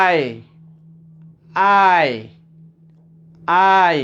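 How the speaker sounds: আই gliding from a to i; model pronunciation. Shown as a demonstration. Teacher models the next letter for learners, drawn-out আই. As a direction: say আই separately, drawn out. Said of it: "এরপরে আমরা যাচ্ছি এইখানে".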